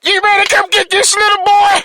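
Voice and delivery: calm down in a squeaky high comic voice; goofy high voice. Pretend. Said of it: "bring in humor whenever I get a little emotional".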